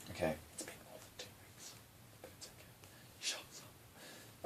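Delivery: quick hushed aside to herself; whispered aside. Real, not acted. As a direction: whisper it under your breath.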